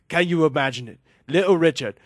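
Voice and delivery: mock contemptuous British rocker rant. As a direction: sneer it in disbelief, leaning hard on the words. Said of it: "says Macon with so much contempt".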